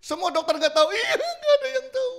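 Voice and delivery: loud high tearful wail; crying woman. Retold or imitated. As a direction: wail it in despair. (ratapan ibu yang menangis)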